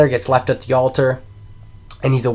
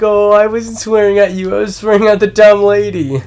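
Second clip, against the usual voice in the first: high, loud mock pleading; hammed-up flustered father; exasperated dad; 9 semitones higher, 7 dB louder than usual. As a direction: protest loudly as to a crying kid. Placates crying kid, insults the clerk.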